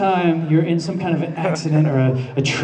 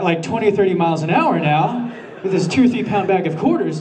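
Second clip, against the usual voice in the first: ordinary talk, nothing marked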